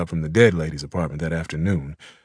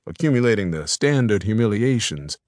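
usual speaking voice

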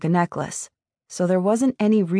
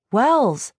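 sharp, higher-pitched snap of the name; snapped; 6 semitones higher than usual. A narrator's reading. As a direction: cut him off with an irritated snap. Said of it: "I snapped".

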